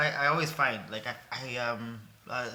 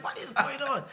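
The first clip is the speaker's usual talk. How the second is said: amazed disbelief; 13 semitones higher, 4 dB louder than usual. Retold or imitated. Retelling own reaction to friend.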